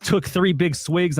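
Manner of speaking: normal talking voice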